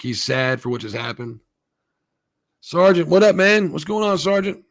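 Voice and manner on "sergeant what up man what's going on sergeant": loud, higher-pitched hail; real upbeat shout-out; calling out a greeting. Real, not acted. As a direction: hail a buddy who just showed up. Streamer calls out to someone arriving.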